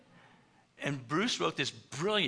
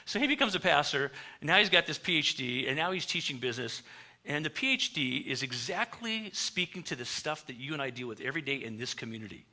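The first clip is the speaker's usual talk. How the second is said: normal talking voice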